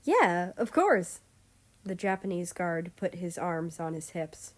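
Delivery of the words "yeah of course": confident reply. Acted. Guard insists hands on hips.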